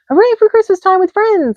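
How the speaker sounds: high loud cheer. A narrator's reading. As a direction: cheer it out together. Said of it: "they all cheered".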